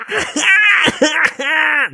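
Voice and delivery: high, falling mock wail; hammed-up wail. Gary keeps wailing at the police station, mock wail. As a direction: let the fake sob trail down. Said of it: "就哭".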